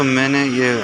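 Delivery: ordinary delivery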